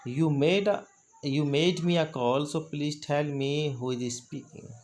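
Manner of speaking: slow, deliberate phrasing; slow model phrase. Shown as a demonstration. Teacher demonstrating a reply to an unknown caller, slow. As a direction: say it slowly so students can copy. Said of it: "आपको बोलना है".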